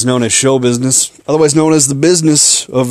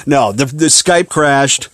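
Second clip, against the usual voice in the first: ordinary delivery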